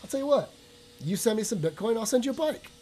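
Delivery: a buddy in Canada pitching a bike swap, lightly voiced; buddy's offer. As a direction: pitch it up like a pal offering a trade. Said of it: "my buddy in Canada is like".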